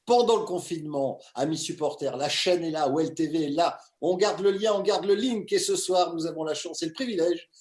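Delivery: loud, high, punchy welcome to the fans; hyped show-opener announcer. Acted. Host welcoming Lyon supporters to the show, loud hyped announcer.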